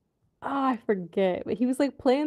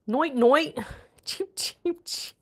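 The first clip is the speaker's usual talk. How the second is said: mock British accent; 4 semitones higher, slower than usual. Playful mock British sign-off.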